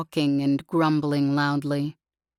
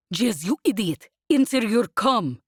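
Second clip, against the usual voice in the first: frantic shout, higher and louder at the end; 5 semitones higher, 3 dB louder than usual. A narrator's reading. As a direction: yell at her to pick up. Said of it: "she sounded frantic".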